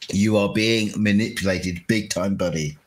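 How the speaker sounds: usual speaking voice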